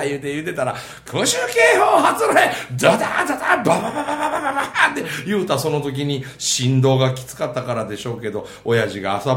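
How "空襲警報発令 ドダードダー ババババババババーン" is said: high, loud announcement then rapid bomb noises; alarm and bombing imitation. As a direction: shout the alert, then rattle off explosions. 警報と爆撃音を演じる. (警報を叫び、爆撃音を早口で真似る)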